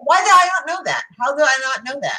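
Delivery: high-pitched disbelief at themselves; how on earth did I miss this; astonished. Real, not acted. Film fan learns who played the role, astonished.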